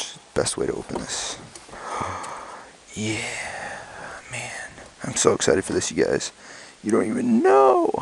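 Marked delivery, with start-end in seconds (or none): 1.8-4.7 soft gasp then hushed awe; whispered amazement over the box; awed whisper. Real, not acted. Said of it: "I'm talking a little quiet". 6.8-7.9 much higher, squeaky with glee; can't contain the excitement; excited squeal. Real, not acted. Excited about unboxing.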